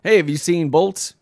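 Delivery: put-on hey, looking for his kid; calling out. Dad calling out loudly, searching for his son.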